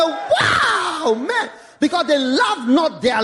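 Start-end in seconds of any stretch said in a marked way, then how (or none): 0.0-1.5 loud, high exclaim; heaven's joy. Retold or imitated. Heaven imitated celebrating, loud and high. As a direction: cheer like heaven rejoicing.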